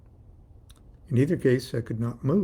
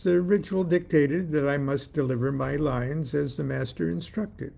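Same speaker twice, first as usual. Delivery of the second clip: usual speaking voice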